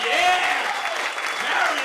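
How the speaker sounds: high, very loud stage holler; hyped shouting. Real, not acted. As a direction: whip up the crowd with yells. Cheering the MC onto the stage.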